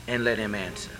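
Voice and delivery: normal talking voice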